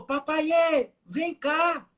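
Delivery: high-pitched, drawn-out mock yell; child's yell. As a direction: imitate a toddler calling out for Papai. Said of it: "Ele gritou".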